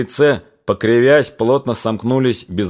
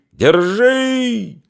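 high piercing cry of держи; piercing shout; 13 semitones higher than usual. A narrator's reading. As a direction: yell держи as if from far off. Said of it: "пронзительный крик".

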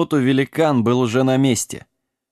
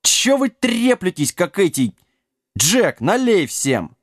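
high-pitched exasperated call; raised voice; 6 semitones higher than usual. A narrator's reading. Horse interrupts the quarrel, raised voice. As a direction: pitch it up over the squabble. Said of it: "возвысил голос лагун-сумасброд".

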